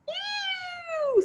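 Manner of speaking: very high disgusted screech. A narrator's reading. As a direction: squeal it in disgust. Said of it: "screeched the princess".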